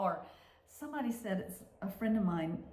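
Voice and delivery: ordinary talk, nothing marked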